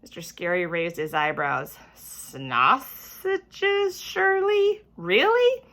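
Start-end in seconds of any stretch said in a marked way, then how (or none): 2.0-5.6 rising higher and higher in disbelief; incredulous. A narrator's reading. Teacher questioning Shirley's odd thankful item, incredulous. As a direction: doubt each word more than the last. Teacher raises eyebrows, doubting.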